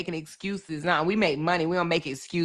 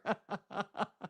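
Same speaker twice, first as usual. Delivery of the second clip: breathy laugh; 10 dB quieter than usual. Real, not acted. Laughing at community wall fights.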